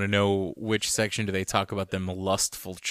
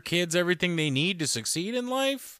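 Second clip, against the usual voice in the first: infomercial voice; 10 semitones higher than usual. Acted. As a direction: sell it like a cheesy commercial. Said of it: "part of the pitch".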